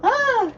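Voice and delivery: sharp high surprised cry. Real, not acted. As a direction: yelp of surprise.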